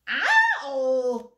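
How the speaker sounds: loud storybook "ow"; yelp of pain. A narrator's reading. Little Owl pricked by Hedgehog's spines, a loud acted yelp. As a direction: cry out as if pricked, playfully acted.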